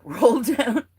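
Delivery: loud real laugh, then high words. Real, not acted. Laughs about rolling down hills.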